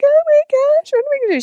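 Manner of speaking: high, loud mock freak-out; squealing child in mock panic over the kitten. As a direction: play a hammed-up panicking kid. Imagined panicked child voiced.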